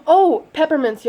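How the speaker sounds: gasp, then a high excited "oh"; just remembered something good; sudden gasp. Real, not acted.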